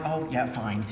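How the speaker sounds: low, soft shrug of a reply; resigned acceptance. Retold or imitated. Director recalls finding the script on the desk, quietly resigned. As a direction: give in quietly, pitch dropped.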